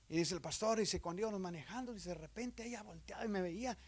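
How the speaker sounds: ordinary talk, nothing marked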